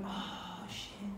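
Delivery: whispered, breathless oh shit; hushed curse. Retold or imitated. Watching her brother fight the fire, a whispered curse. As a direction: breathe it out under your breath.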